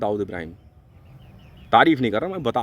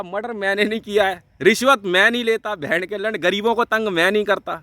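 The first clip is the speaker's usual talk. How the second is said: ordinary talk, nothing marked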